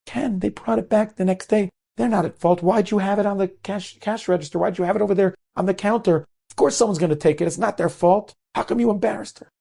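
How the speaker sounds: high-pitched exasperated reproach; indignant scolding. Retold or imitated. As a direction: take the cashier to task. Manager scolds cashier, defends the customers.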